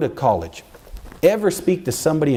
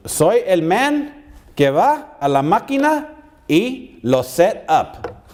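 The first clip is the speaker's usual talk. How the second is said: slow, high, drawn-out father; dramatic father quote; 6 semitones higher, slower than usual. Retold or imitated. Father explains his job to his son, slow and dramatic. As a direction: spell it out slowly for drama. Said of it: "for effect, drama".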